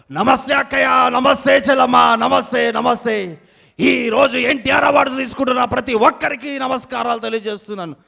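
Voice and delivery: shouty mimicry voice; impersonated crowd-greeter. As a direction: hail the crowd like a rally speaker.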